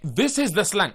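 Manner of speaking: ordinary delivery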